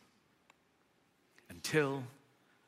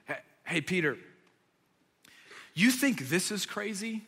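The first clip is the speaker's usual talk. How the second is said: normal talking voice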